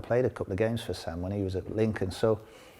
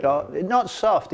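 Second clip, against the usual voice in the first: slow, higher, emphatic no; correcting the host, slow and emphatic; firm denial; 10 semitones higher, 6 dB louder, much slower than usual. Real, not acted. Rejects calling his dad soft.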